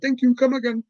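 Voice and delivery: high, lilting mock Indian accent; see the customer off in a comic Indian accent; singsong Indian-accented cashier. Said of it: "generic Indian character".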